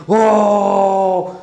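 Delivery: long, loud mock yell "oh"; imitated boys' shout. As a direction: holler like the shouting boys. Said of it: "એવી બૂમો પાડી". (છોકરાઓની બૂમની નકલ)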